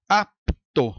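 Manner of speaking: "Apto" broken as "Ap... to", P closing the first; split into syllables. Shown as a demonstration. Teacher showing consonants in different syllables, "Apto" split apart. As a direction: pause between the P and T of "Apto". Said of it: "P com o T em sílabas diferentes".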